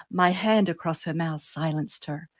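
ordinary delivery, nothing marked

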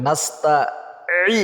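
নাস্তাঈ slow, with the আইন pressed from the middle of the throat; slow careful নাস্তাঈ, rising at the end; correct throat sound. Shown as a demonstration. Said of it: "নাসসিনের উচ্চারণ করতে হবে".

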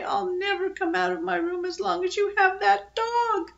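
high, mock-crying little-girl voice; tearful whine. A narrator's reading. Girl locked in her room over the dog, high tearful whine. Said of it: "snuffle and cry and say".

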